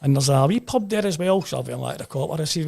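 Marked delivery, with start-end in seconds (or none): 0.0-1.4 put-on loud, high old local man's Shooter accent. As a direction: play the old boy with a thick Shooter accent, pitched well up. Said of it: "You know, that Shooter accent".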